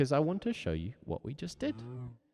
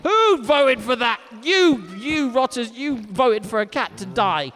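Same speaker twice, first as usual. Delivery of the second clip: hammed-up telling off of the voters; mock outrage; 13 semitones higher, 11 dB louder than usual. Teacher scolding chat in hammed-up outrage.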